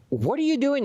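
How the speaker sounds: mock-outraged question; retold indignant demand. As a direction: imitate a woman demanding answers. Retold angry woman at the door.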